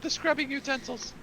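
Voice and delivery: high, urgent recruit voice; eager pirate recruit. Acted. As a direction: fire off questions, raring to scrub. Eager recruit wants cleaning tools.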